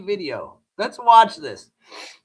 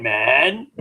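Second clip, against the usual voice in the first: deep mock man voice; 11 semitones lower than usual. Streamer mocking a first lady as manly, deep mock voice. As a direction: drop way lower for a booming man.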